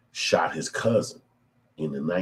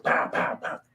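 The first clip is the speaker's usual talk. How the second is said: gunshot sounds; slower than usual. Retold or imitated. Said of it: "He said".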